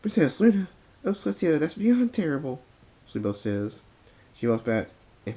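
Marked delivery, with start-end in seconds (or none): 0.0-2.6 high, loud horrified outburst; dismayed cry. Acted. Filly hears Luna captured.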